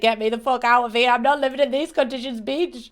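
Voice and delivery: voicing the Sim's complaint. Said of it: "she said".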